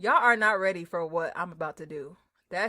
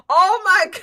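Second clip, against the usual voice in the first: excited squeal; 10 semitones higher, 16 dB louder than usual. Real, not acted. Laughing greeting a friend.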